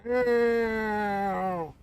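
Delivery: high, long falling meows; cat meow. Retold or imitated. Imitating the cat wailing in the window, high meows. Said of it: "and he'd start".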